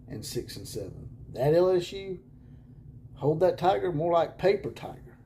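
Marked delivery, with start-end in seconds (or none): 1.3-5.2 loud, high mocking jab; mocking taunt. Real, not acted. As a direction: taunt the rival fans.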